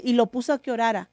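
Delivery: usual speaking voice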